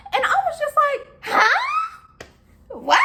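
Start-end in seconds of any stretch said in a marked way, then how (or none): 1.2-3.0 high-pitched, incredulous yelp; stunned disbelief. Retold or imitated. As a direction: act out being floored, voice shooting up. Stunned reaction to being yelled at.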